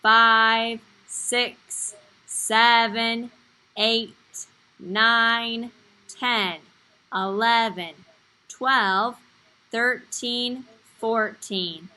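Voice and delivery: loud, slow counting to a class; kids show host. Shown as a demonstration. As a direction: count along slowly for young kids. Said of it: "Let's count together, everybody".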